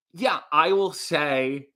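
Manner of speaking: usual speaking voice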